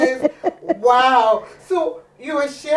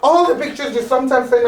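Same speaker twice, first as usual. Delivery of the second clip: ordinary delivery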